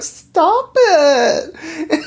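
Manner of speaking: high, laughing "Stop it."; giggly protest. Retold or imitated. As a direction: protest while being tickled, giggling. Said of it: "she's like".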